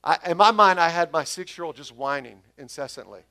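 excited preacher; animated and emphatic. Real, not acted. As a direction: raise your voice as the story builds. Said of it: "just do your best grumbling voice for me".

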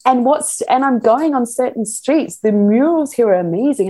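usual speaking voice

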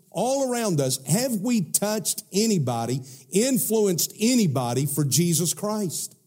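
high, rising sermon cry; fiery preaching. Acted. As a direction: preach it out with urgency. Preaching at full force.

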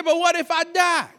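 high, loud mock protest; acted high-pitched panic; panicked son. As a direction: whine in fear, hammed-up.

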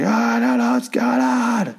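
high, drawn-out hysterical wail; imitated high-pitched meltdown; mock freak-out. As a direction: wail it in exaggerated panic. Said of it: "Clegg freaks the fuck out".

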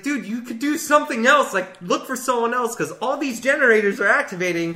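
a lightly hammed-up annoyed complaint; exasperated plea. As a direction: urge the killer to give up the chase. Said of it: "I was like".

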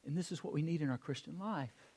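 ordinary talk

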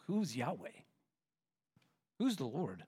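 hushed, sneering Pharaoh; scoffing Pharaoh. Acted.

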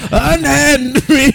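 very high, slow damsel cry; fluttering cries for help. Pretend.

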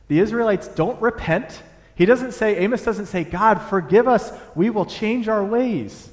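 rising preacher's emphasis; emphatic preaching. Real, not acted. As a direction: preach it with full force. Preaching point at full force.

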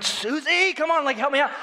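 high, whiny begging; mock pleading. Pastor begging the county clerk for help, high mock whine. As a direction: wheedle her for a favour. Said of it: "And I was like".

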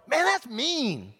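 high-pitched, drawn-out protest; mock outrage. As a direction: react with playful shock, pitch way up. Mock outrage at Goliath's threat.

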